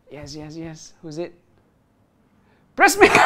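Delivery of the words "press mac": high, loud name call; excited shout. Real, not acted. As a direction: burst out the winner's name. Surprised call of the winner.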